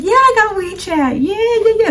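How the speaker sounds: high, chirpy imitation of mom; proud mom. Daughter imitating her mom bragging about WeChat, high. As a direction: pitch way up, smug and cheerful.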